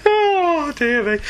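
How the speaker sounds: tearful from laughing. Real, not acted. Host wipes tears after laughing at Deathmate. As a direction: gasp it out still laughing.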